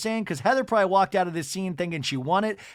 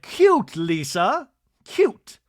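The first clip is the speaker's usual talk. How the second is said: sarcastic cute; 5 dB louder, much slower than usual. Retold or imitated. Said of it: "And Bronwyn's like".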